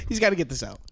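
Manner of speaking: said low and quiet to bystanders; quiet explanation on a friend's behalf; confiding aside. Retold or imitated. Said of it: "Look at me and Gabe Ramirez and just go like".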